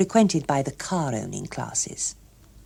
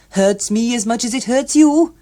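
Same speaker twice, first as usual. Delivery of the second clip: higher, louder jovial voice; loud cheery official; hearty official; 6 semitones higher, 7 dB louder than usual. Acted. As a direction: boom it out cheerily. Hughes Evans voiced loudly.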